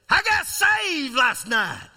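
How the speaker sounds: high, loud, slow joyful news; overjoyed, drawn-out proclamation; joyful announcement. Retold or imitated. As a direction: announce it like the best news ever.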